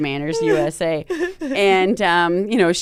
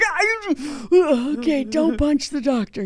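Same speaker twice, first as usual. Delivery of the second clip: higher voice, softening and slowing at the end; retold pained self-restraint; 7 semitones higher, slower than usual. Patient in pain resisting hitting the doctor, strained self-coaching. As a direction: talk yourself down through mimed pain. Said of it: "when you're in that much pain".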